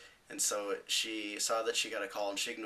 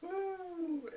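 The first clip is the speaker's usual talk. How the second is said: thin, very high-pitched ooh; drawn-out ooh way up high; high sustained "ooh". Retold or imitated. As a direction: let out a long, high "oooh". Reacting as she storms out.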